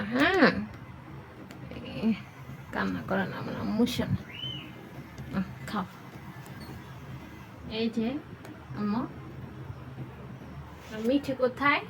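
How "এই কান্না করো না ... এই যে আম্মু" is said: hushed, gentle coaxing to stop crying; soft soothing. Real, not acted. Mother hushing her crying little girl, soft and soothing. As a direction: soothe her softly so she stops crying.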